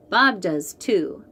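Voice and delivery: plain reading voice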